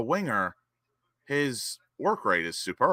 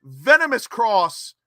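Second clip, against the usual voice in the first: lifted, higher play-by-play call; replay commentary; 9 semitones higher than usual. Acted. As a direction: talk the viewers through the goal. Live play-by-play of the goal replay.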